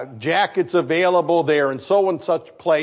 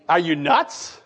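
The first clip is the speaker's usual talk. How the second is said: ordinary delivery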